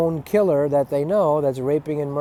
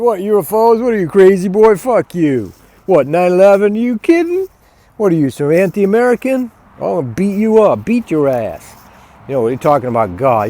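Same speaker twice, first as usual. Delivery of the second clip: mocking thug; 4 semitones higher, 3 dB louder than usual. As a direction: sneer like an aggressive bully. Mocking programmed people.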